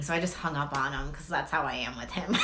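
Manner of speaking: normal talking voice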